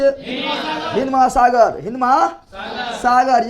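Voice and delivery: slow, drawn-out classroom drill. Shown as a demonstration. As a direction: stretch each word out for the class to repeat. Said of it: "Hind महासागर हिंदुस्तान के नीचे।".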